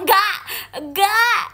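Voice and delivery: loud, high-pitched, sharp no; emphatic refusal. Real, not acted. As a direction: refuse it loudly and firmly. Refusing what chat suggests. (menolak keras)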